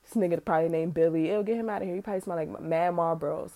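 quiet snide aside; muttered prejudiced thought. Retold or imitated. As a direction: mutter it like a private sneer. Voicing a prejudiced inner thought.

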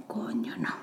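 breathy whisper of dismay; whispered curse. Retold or imitated. Woman remembering meeting a stranger, whispered dismay. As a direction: whisper it under your breath. Muttered realization in retold scene.

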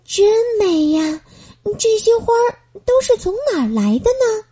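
put-on a girl stunned by a field of flowers; amazed wonder. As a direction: marvel at all the flowers. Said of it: "美宝深深地吸了一口气".

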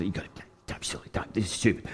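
hushed, quick whisper of disbelief; whispered retort, half to yourself; whispered protest. Retold or imitated. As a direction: mutter it under your breath, scoffing. Arguing back at God's prompting.

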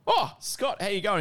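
high-pitched, caught-out hello; retold surprised greeting. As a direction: imitate a teacher startled by a former student. Drunk teacher surprised by former student.

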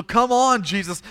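high, loud eager call; ecstatic urging. Acted. Ecstatic father leading Jesus home, excited urging. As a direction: burst out eagerly, then ease off on the name. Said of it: "He's ecstatic".

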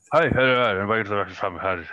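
hurried, loud intro in a put-on Irish lilt; mock Irish accent. Podcast host greeting listeners in a hammed-up Irish accent. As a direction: rattle off the welcome in a jokey Irish accent. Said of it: "I don't know why it's Irish now".

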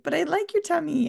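put-on high, sweet child voice; little boy's voice. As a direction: play the boy objecting innocently. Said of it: "he was like".

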